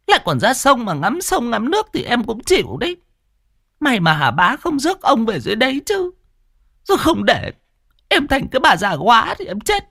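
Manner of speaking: high, nagging wife's complaint; worried wife nagging her sick husband; fretful scolding. Acted. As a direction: scold him out of fear. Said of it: "lo lắng sang sợ sệt rồi bực bội".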